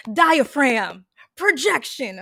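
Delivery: loud stage voice from the diaphragm; theater projection. Shown as a demonstration. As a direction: project it to the back row. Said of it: "my default truly is".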